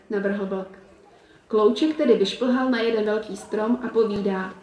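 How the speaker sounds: ordinary delivery, nothing marked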